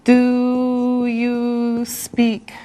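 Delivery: very slow, words held long and joined; drawn-out teacher demo, very slow; slow modelled question. Shown as a demonstration. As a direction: stretch every word out for the class. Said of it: "and how you say it".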